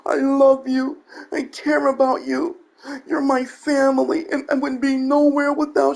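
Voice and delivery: high, gushing fake-sincere streamer voice; mocking YouTuber. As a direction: parody the sappy thank-you speech. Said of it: "tell your viewers".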